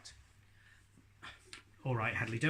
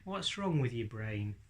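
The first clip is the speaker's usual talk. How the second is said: normal talking voice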